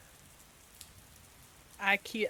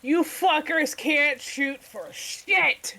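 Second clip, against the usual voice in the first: higher, slow taunting yell; 7 semitones higher, 5 dB louder, slower than usual. Acted. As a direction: bellow a mocking taunt. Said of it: "just yell".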